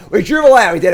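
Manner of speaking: loud, high triumphant call; excited cheer. Real, not acted. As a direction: celebrate the draw. Excited after drawing land.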